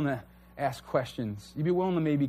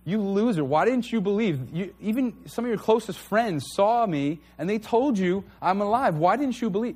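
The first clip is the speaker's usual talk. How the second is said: higher, mocking scolding voice; scornful rebuke; 6 semitones higher, 3 dB louder than usual. A harsh God scolding doubting Thomas. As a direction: sneer down at a doubter. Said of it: "Jesus doesn't look back at him and say".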